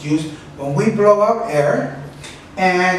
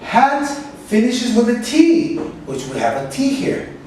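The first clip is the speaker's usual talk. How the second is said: ordinary talk, nothing marked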